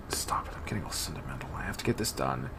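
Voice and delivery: hushed, fast self-scolding whisper; whispered to herself. Acted. Girl scolding herself at the shrine, whispering. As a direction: catch yourself and whisper it away.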